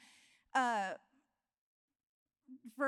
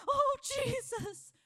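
high, slow, loud whimpering plea; mock a believer begging in a panic; cowering plea; 8 semitones higher, 3 dB louder than usual. Cowering believer begging Jesus for help, hammed-up high wail. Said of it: "So why do we cower?".

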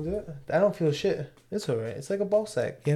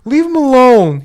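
high, loud and slow; slow down and scold loudly; stern call-out; 7 semitones higher, 6 dB louder than usual. Pretend. Echoing the call-out.

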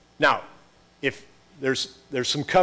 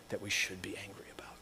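dramatic whisper; 6 semitones lower, 17 dB quieter, faster than usual. Acted. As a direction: whisper it, much softer and slightly quicker. Repeats line, drops to whisper.

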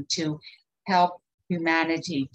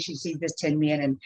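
normal talking voice

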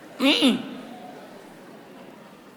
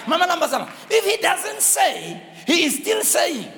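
preaching; 7 semitones higher than usual. Acted. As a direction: preach it out with fire. Preaching at full force.

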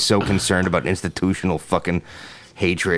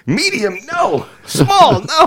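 hunting sizes; 3 dB louder, slower than usual. Retold or imitated. Searching racks for hoodie size.

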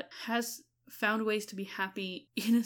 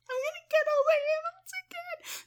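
high wailing groan of dread; playful high-pitched lament; mock despair; 4 dB louder than usual. Real, not acted. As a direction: wail it way up in comic exasperation.

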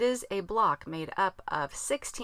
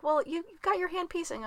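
mock friends; 11 semitones higher than usual. Friends' reply voiced mockingly.

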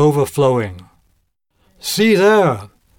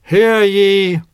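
town crier; 5 semitones higher, 3 dB louder than usual. Acted. Town crier calls out decree.

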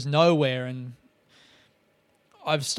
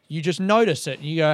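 usual speaking voice

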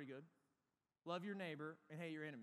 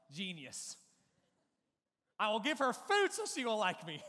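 excited scheme; 2 semitones higher, 9 dB louder than usual. Retold or imitated. Excited kid's plan retold.

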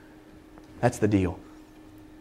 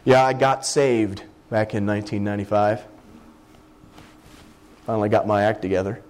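mock self-satisfied; 7 semitones lower than usual. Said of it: "my language is".

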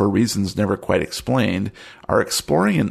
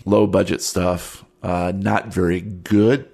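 ordinary talk

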